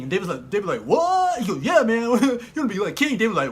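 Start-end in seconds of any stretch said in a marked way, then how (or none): 0.8-1.4 high, loud squawk of disbelief; stunned high-pitched yelp; astonished. Acted. David astonished at being made king. 1.6-3.2 higher, louder hyped-up dude; pumped, laughing insistence; excited assurance. Acted. Excitedly assuring David he'll be king.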